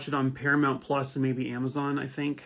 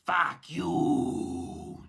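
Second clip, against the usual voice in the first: almost French; 4 dB quieter than usual. Pretend. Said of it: "that sounded almost French".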